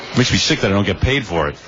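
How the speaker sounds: normal talking voice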